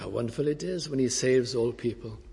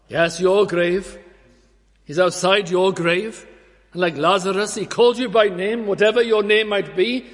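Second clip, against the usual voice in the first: higher and louder, pressing each word; impassioned preaching; 7 semitones higher, 8 dB louder than usual. Acted. Preacher telling listeners Christ calls them by name, impassioned. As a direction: preach it with full force.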